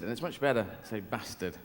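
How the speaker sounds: ordinary delivery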